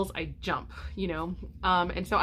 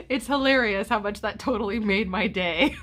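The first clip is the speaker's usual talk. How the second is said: reading aloud through real laughter; delighted laugh reading her son's message; 4 semitones higher, 4 dB louder than usual. Real, not acted. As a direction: chuckle as you read the text.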